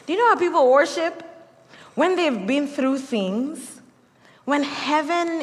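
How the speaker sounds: a quick, fired-up, high challenge; excited preaching. Real, not acted. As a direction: burst out in awe. Preacher building to climax.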